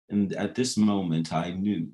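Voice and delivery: lightly put-on proper old American English accent; proper American English accent, lightly imitated; Alan Watts. Shown as a demonstration. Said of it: "a proper American English accent".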